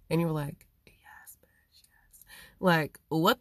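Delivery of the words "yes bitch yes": whispered, thrilled yes; excited whisper. Retold or imitated. Imagining saying yes to paradise, in an excited whisper. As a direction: breathe it like a secret victory.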